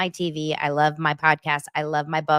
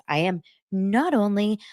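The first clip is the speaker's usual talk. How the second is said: ordinary talk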